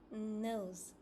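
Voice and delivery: ordinary talk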